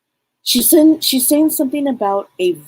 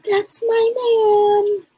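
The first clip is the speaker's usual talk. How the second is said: much higher, very slow, proud wifely voice; wife voiced; much slower than usual. Psychic voices the late wife praising her husband, high and slow. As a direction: stretch it out high and fond. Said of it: "She's like".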